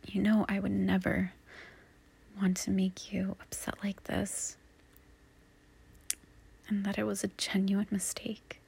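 soft unvoiced reassurance; reassuring whisper. Reassuring a partner after a fight, soft whisper. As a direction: whisper it gently so they believe you.

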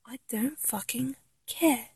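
very soft, whispered defiance; hushed defiance. Acted. Fan dismissing being thought bonkers, hushed defiance.

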